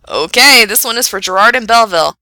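loud, lifted dedication on air; radio DJ announcing. Acted. Frank dedicates the caller's song, loud DJ announcement. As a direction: project it like a song intro.

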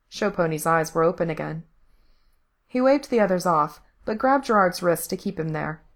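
ordinary delivery

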